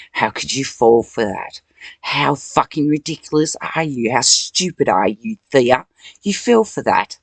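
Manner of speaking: loud, sneering inner voice; mock the weakling with scorn; Satan's taunts. Satan taunting her in her head, loud sneering voice. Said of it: "Satan Hashatan was the one that was telling me".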